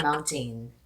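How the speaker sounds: Filipino-accented mountain. Shown as a demonstration. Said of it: "You say, like".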